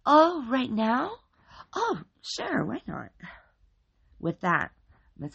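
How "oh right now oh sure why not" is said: high, bright-voiced cheerful lady; high cheery neighbor lady. A narrator's reading. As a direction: answer sweetly like a cheerful hostess. Miss Hatchetface cheerfully agrees.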